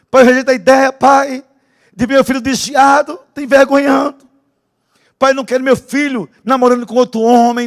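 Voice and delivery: loud, high pleading prayer; shouted prayer. Retold or imitated. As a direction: plead with God at the top of your voice.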